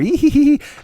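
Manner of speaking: high, silly put-on tee-hee; mock giggle. Porn star teasing a scene later, mock giggle. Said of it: "they would just giggle".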